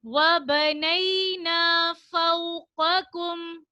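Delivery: slow melodic tilawat with drawn-out vowels; Quran recitation. Shown as a demonstration. Teacher reciting the verse to the children, slow melodic chant. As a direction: chant the verse slowly like a qari.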